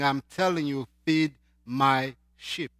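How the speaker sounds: normal talking voice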